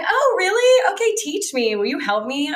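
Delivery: sugary high fake innocence, loud on really; a sarcastic wide-eyed fake innocence; mock naive. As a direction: play dumb and sweet to flatter him. Mocks condescending man.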